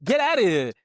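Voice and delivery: high, loud, fed-up venting; exasperated outburst. Retold or imitated. New father fed up with the nurse, exasperated and loud. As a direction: let your frustration burst out at the nurse. Exhausted parent snapping at nurse.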